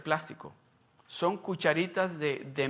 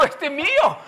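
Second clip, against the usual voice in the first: a much higher, louder, laughing claim on the ice cream; playful possessive shout; 10 semitones higher, 12 dB louder than usual. Retold or imitated. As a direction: shout it half-laughing, guarding your dessert. Defiende su helado de Ligia. (grita riendo al defender su helado de Ligia)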